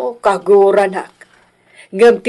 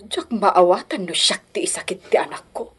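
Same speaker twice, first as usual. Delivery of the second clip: hushed, near-whispered lament; softly, almost to yourself; quiet, wounded murmur; hurt lament; 3 semitones lower, 8 dB quieter than usual. Acted.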